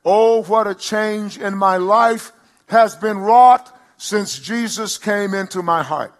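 slow, loud, lifted testimony; declaimed hymn line. Acted. Pastor declaring the hymn as testimony, slow and loud. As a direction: draw out each word like a hymn.

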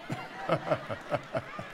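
low, unvoiced chuckling; amused laugh. Real, not acted. Preacher tickled by "help your crazy self", chuckling. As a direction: let a run of soft chuckles out.

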